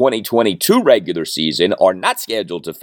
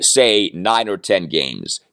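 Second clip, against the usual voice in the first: ordinary delivery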